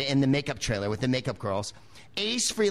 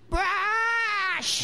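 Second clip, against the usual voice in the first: much higher, cracking Muppet bark; Muppet-like Ace. Retold or imitated. [name] demands the brush once more, high Muppet squawk. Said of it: "he was like a Muppet".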